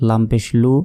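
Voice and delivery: ordinary delivery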